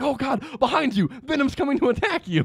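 high-pitched mock-alarmed cry; panicked warning. Guard warns Parker that Venom is behind him, panicked.

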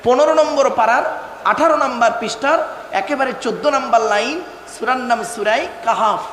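ordinary delivery

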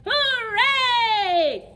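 put-on high, loud hooray for the kids; cheering shout. Teacher leading kids in a cheer, loud happy shout. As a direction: cheer it out big. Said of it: "shout hooray".

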